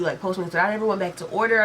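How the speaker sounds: usual speaking voice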